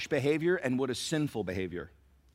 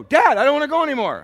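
put-on much higher, much louder and fast, a child's wail; whiny child voice; 15 dB louder, faster than usual. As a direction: whine it like a stubborn kid. Son whines in retold story.

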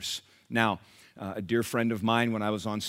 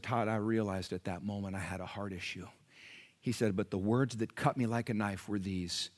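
hushed, solemn; 2 semitones lower, 7 dB quieter than usual. Retold or imitated. Preacher retelling Nelson's moment of conviction, hushed and solemn. Solemn turning point of testimony.

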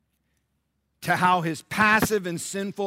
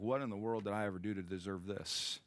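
soft retold lament; 6 semitones lower, 13 dB quieter than usual. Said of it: "and she's crying".

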